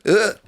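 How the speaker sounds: hammed-up ugh; mock disgusted ugh. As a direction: toss off a grossed-out ugh. Mock reaction to a snake.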